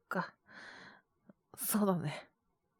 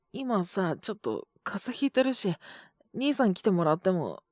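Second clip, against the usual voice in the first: usual speaking voice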